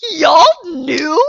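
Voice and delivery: high, very loud aghast screech; hammed-up flabbergasted yell; open-mouthed disbelief. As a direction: screech it as if stunned they kept it secret. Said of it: "sitting there with my mouth open going".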